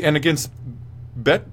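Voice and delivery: ordinary delivery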